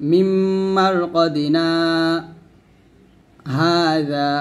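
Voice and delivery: slow recited মিম্মার কদিনা, a breath-held gap, then হাজা; saktah pause. Shown as a demonstration. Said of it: "মিম্মার কদিনা এবং হাজা দুটি শব্দ". (কদিনা-র পর শ্বাস ধরে রেখে থামা)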